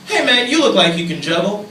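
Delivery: eager out-of-the-blue remark; strange guy's blurt. Retold or imitated. Strange man approaching someone he never met, eager blurt. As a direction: blurt it at a stranger with an upward end. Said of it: "this dude is a strange person".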